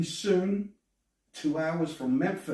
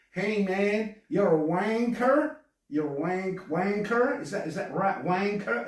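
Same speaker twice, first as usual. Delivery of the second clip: higher American drawl trying British slang; mock American accent; 4 semitones higher than usual. Host mocks Americans faking a British accent, exaggerated American twang. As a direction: mimic an American fumbling British slang, rising at the ends. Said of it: "those dudes that try to speak, uh, with a British accent".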